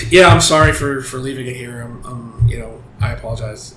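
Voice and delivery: low, halfhearted mock apology; indifferent apology. Hitman covering for his friend to the angry wife, indifferent. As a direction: act out an apology with no care in it. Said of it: "he's just completely indifferent to the whole thing".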